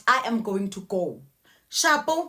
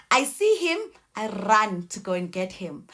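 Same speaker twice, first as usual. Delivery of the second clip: normal talking voice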